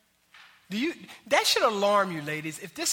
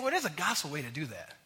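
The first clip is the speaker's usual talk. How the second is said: gentle voice of the Lord; 5 semitones lower, 6 dB quieter than usual. Retold or imitated. The Lord answering the quarrelsome wife, soft and gentle. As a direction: lower and soft, calmly correcting. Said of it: "he's just saying".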